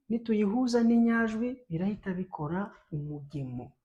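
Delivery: usual speaking voice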